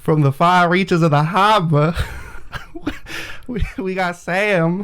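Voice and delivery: attempted Boston accent. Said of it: "The last part wasn't even Boston".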